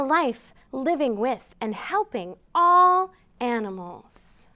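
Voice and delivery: gentle picture-book read-aloud; storytime reading. A narrator's reading. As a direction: read it slowly to little listeners.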